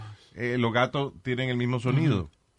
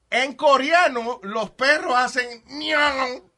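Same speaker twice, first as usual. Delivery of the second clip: high, loud, drawn-out announcement; incredulous reveal; 12 semitones higher, 4 dB louder, much slower than usual. Real, not acted. Telling co-hosts how Korean dogs bark, incredulous. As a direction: stretch it out in loud disbelief. Amazed reveal of absurd dog sound.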